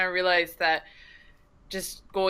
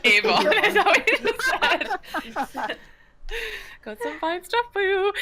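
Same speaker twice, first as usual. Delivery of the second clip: laughing; 13 semitones higher, slower than usual. Acted. Laughing, joining saleswoman bit.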